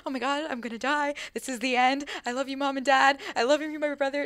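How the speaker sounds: retelling her fourteen-year-old panic, lightly hammed; mock freaking out. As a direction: play up the fear of dying. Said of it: "freaking out".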